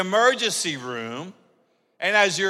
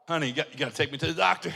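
fast, soft, lower urging; a husband quietly waking his wife at midnight; urgent hush; 5 semitones lower, 8 dB quieter, much faster than usual. Retold or imitated. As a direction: hurry her quietly awake. Waking wife in retold story.

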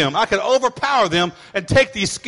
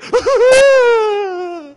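mock kid laugh; 13 semitones higher, 8 dB louder than usual. Kids keep the Skittles.

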